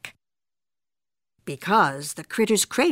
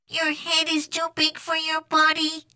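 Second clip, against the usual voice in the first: high, level monotone; Martian echo; 8 semitones higher than usual. A narrator's reading. Martian copying Skipito's words, monotone echo. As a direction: repeat it evenly like an alien parrot.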